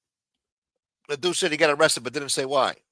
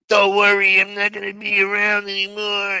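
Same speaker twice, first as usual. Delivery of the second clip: a hint of a higher put-on pout; mock sulking friend; 8 semitones higher, 6 dB louder than usual. Imitating Matt sulking at him, lightly voiced. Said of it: "He didn't say all that".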